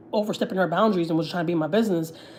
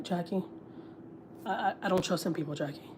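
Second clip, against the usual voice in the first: much softer, hushed and anxious; worried mother; 13 dB quieter than usual. Retold or imitated. Her mother warning her daughter, hushed and worried.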